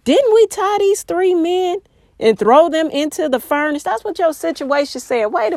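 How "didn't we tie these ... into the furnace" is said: higher, a touch of astonished disbelief; amazed exclaim. A narrator's reading. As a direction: read the king's amazed question. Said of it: "exclaimed to his advisors".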